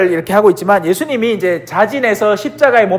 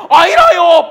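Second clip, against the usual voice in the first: "아이라요" said higher, laughing through it; laughing denial; 9 semitones higher, 4 dB louder, slower than usual. Retold or imitated. Peter denies again, laughing higher mock voice. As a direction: deny again while giggling. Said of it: "옆에 웬 아낙네가".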